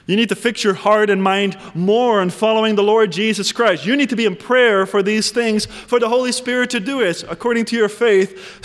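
ordinary delivery

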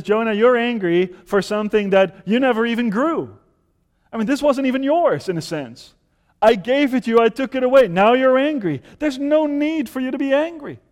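high, loud pleading rebuke as God; God rebuking Jonah. Acted. God to Jonah, a loud, high, pressing rebuke.

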